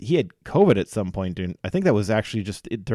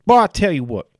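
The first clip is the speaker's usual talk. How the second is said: put-on Southern accent; 9 semitones higher, 6 dB louder than usual. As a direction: ham it up like a good ol' Southern boy. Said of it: "Southern and Cajun type, uh, accents".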